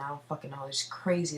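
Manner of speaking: ordinary delivery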